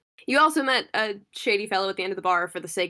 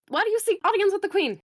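high, loud page voice; 12 semitones higher, 4 dB louder than usual. Acted. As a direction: squeak it out like a pompous page.

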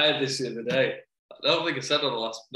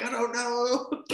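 imitating a child; 11 semitones higher, much slower than usual.

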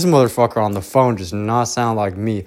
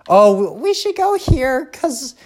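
much higher, lightly voiced eager friend; mock enthusiastic friend; 11 semitones higher than usual. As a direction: imitate a friend pitching a trip idea. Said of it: "Nobody's like".

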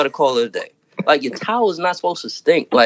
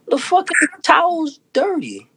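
outraged disbelief; 8 semitones higher than usual. Retold or imitated. Outraged at the dirty towel.

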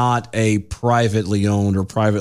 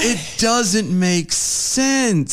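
exasperated drawl; 7 semitones higher, much slower than usual. Real, not acted. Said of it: "My head hurts so bad".

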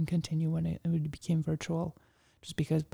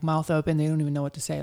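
usual speaking voice

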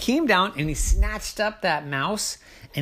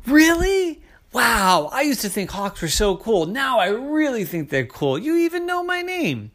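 much higher, awed gushing; starstruck crow; 7 semitones higher than usual. Acted. Ike gushing at the hawks, high and awed. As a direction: gush like a starstruck fan.

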